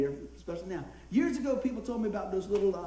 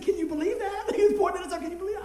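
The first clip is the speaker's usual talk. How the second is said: high, excited retelling of the man's delight; excited quote; 9 semitones higher, 5 dB louder than usual. Retold or imitated. Pastor retelling the man at church, high and thrilled. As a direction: mimic him pointing, thrilled and high. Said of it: "He said".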